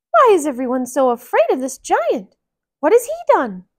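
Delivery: high, rising bewildered hero; Mickey asking the crowd, high and puzzled; puzzled woodcutter. Acted. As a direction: ask as the baffled young woodcutter.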